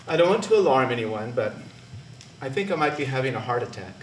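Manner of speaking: low, soft, evenly measured understatement; calm, even voice. Retold or imitated. Worker sidling up to a manager about chest pain, calm and even. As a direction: play it calm and quiet, alarming nobody. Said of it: "in my calm, even voice".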